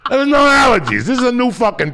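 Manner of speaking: high, loud ranting shout; loud comic outrage; exasperated shout. Real, not acted. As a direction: shout it out, fed up. Exasperated rant about allergies.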